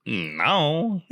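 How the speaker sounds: high-pitched imitation of a refusal; playfully hammed-up reenactment; reenacted game character. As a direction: mimic an oddly voiced game line. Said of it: "I gotta reenact it".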